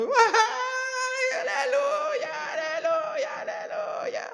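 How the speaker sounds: high-pitched ecstatic cries of alleluia, hammed-up shouting; mock ecstatic preacher. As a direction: parody a preacher swept away, crying out high. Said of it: "imagínense, yo estoy predicando".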